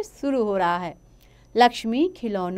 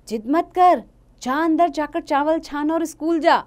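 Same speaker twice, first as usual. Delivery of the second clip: louder, higher mother shooing her off; scolding mother; 7 semitones higher, 5 dB louder than usual. Acted. Mother scolds Lakshmi off to school, firm. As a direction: send the child off firmly.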